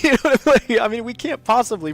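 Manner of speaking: high, loud and giggly; laughing. Real, not acted. Vlogger laughing about pronouncing foreign words, loud and high. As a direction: still laughing through the words.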